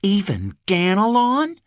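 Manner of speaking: Even Ganelon rising high in surprised echo. A narrator's reading. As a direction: repeat Even Ganelon as a startled question. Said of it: "she echoed".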